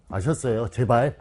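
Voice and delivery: usual speaking voice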